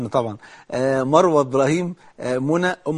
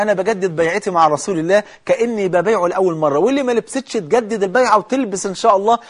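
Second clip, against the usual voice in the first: rising, louder preaching exhortation; fervent preaching; 4 semitones higher, 3 dB louder than usual. Acted. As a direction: urge them on with growing fervor. Preacher exhorts sisters to renew pledge. (وعظ حماسي بصوت مرتفع)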